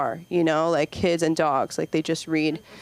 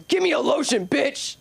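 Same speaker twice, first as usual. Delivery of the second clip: high, brash demand; mock rude robber; 7 semitones higher than usual. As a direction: shoplifter barking at the manager.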